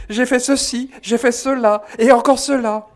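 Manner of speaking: high, loud mock-panicked confessing; loud confession. Une dame confesse ses péchés devant la foule, aveu criard paniqué. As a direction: blurt out sins in a frantic loud voice. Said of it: "disait à haute voix".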